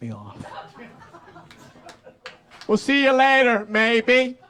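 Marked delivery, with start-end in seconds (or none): 2.7-4.4 breezy parent voice, higher; casual parent waving the kid off; parents dropping me off. Retold or imitated. Parents dropping the kid at the park, breezy.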